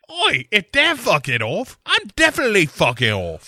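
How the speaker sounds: high mock cockney; cockney quitting. Third Spice Girl to Clifford, high mock cockney. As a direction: bail out in put-on cockney.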